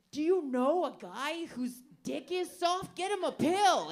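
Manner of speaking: high, loud, slow urgent ad voice; hammed-up emergency ad read; mock commercial announcer. Comic mocking erection-pill ads, urgent announcer voice.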